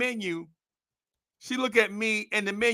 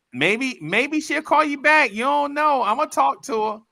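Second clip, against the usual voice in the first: lightly voiced grandmother; 9 semitones higher, 3 dB louder than usual. Grandmother consoling him.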